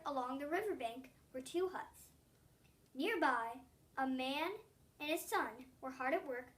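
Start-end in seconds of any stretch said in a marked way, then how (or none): none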